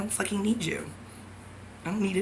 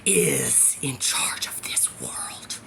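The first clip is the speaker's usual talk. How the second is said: lower, slow, voiceless whisper; sudden whisper; 7 semitones lower, 5 dB quieter, slower than usual. Real, not acted. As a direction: drop to an ominous hush mid-rant. Sudden drop inside the rant.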